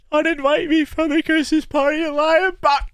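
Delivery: high, laughing as the denial breaks up; real laughter at own exaggeration; laughing disclaimer. Real, not acted. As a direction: giggle through the joke.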